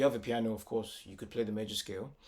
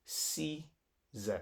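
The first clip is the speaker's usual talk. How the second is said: "C Z" as two separate syllables of ceases; broken into syllables; 5 semitones higher, 4 dB quieter than usual. Shown as a demonstration. Teacher finishes counting eleven syllables, "C Z" spaced apart. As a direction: say "C Z" apart, with a pause between. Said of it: "That's eleven syllables".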